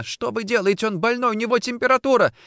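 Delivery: high, hurried pleading cry; frantic protest. Acted. Wife pulling Nikitin away, frantic protest. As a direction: protest in panic, speeding up.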